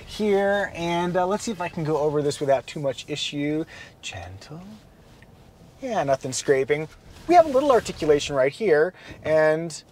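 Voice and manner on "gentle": quiet. Real, not acted. Easing over roots, to himself.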